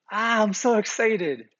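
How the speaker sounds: high, loud imitation of thrilled kids; brimming with joy. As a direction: burst out like an overjoyed child. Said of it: "brimming with joy".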